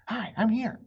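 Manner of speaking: high, loud sputtered call; high loud spluttering call; sputtering. Acted. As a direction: splutter through the water. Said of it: "Annie sputtered".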